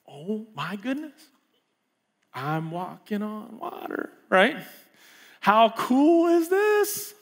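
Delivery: hushed slow amazement rising to a high thrilled cry; awed. Retold or imitated. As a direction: marvel at walking on water. Peter amazed walking on water.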